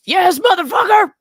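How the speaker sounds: high, loud mock outburst; mimicking deponent's voice. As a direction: blurt it out in imitation. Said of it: "with you in that voice".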